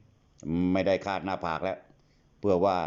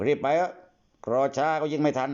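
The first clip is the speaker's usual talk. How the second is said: urging hurry; 6 semitones higher than usual. Acted. Phong urges hurry.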